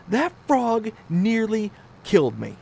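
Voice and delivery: high, loud, emphatic claim; hammed-up outrage; melodramatic wife. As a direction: announce a near-death experience. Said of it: "I'm making her sound like she's, like, this crazy person".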